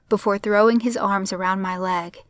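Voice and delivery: ordinary delivery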